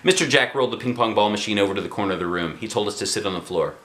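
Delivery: plain reading voice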